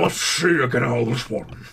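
slurred, noisy sea-villain voice; Davy Jones. Acted. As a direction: slur it out like a cartoon octopus pirate. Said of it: "You sound like Davy Jones".

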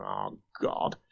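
breathy whispered oh God under the breath; whispered dread. Retold or imitated. Thinking of the phone call, a whispered dread. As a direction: let out a hushed, worried oh God.

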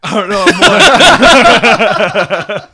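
high, loud mocking echo of the drunk reply; squeaky mock echo of a soaked drunk; mocking drunk whine. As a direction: parrot him in a silly squeaky voice. Friend mimics the drunk reply.

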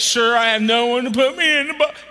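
loud, high plea of the sick man; fervent mock plea; sick man pleading. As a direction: beg for help, preached. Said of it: "The sick man answered".

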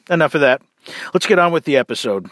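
normal talking voice

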